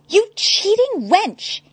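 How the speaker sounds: high, slow outraged accusation; accusing. A narrator's reading. Nigma accusing Harley of cheating, outraged. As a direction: spit the accusation at her. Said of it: "he accused".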